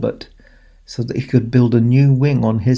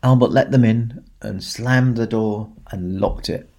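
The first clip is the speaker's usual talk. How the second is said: ordinary delivery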